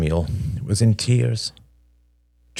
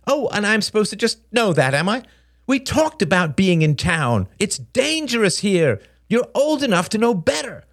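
high, sarcastic scolding; shrilly pitched telling-off; exasperated mother; 13 semitones higher than usual. Acted. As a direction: lecture a child at the end of patience.

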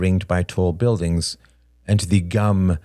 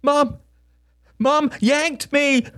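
put-on very high, drawn-out child's wail; high whiny cry of complaint; crying little boy; slower than usual. A narrator's reading. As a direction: protest like an upset six-year-old. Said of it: "cried Samuel".

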